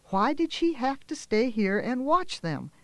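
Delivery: usual speaking voice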